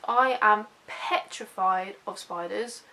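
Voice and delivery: normal talking voice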